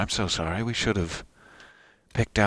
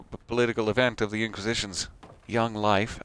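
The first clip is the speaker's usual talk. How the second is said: normal talking voice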